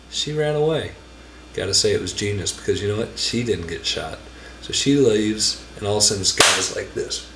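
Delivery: ordinary talk, nothing marked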